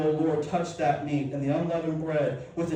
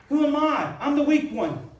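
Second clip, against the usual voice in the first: pleading Gideon; 8 semitones higher, 4 dB louder than usual. Acted. Pastor voices Gideon's excuse.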